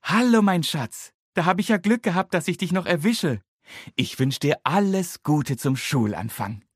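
cheerful granny greeting; Oma. A narrator's reading. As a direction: grandmother phoning with cheer. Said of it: "Es ist Oma".

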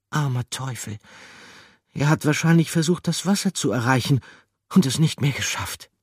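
a touch of pity; pitying character. Acted. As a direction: feel sorry for the little fellow. Character pities the mouse.

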